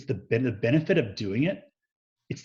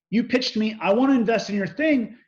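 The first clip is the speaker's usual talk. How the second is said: higher, louder imitation of the investor's rant; retold yell; 9 semitones higher, 4 dB louder than usual. Investor ranting at him over the call, mock yelling. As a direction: replay his angry complaint at a raised pitch. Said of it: "he yells at me".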